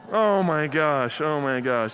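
stretched-out groaning realization; exasperated dismay. Retold or imitated. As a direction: let it sink in, deflated. Dad's dismay at kids' answer.

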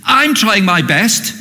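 mock frustrated complaint. Churchgoer inwardly ranting at God, rising to angry outburst. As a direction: whine your grievances to God until it bursts out. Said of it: "And inside you're going".

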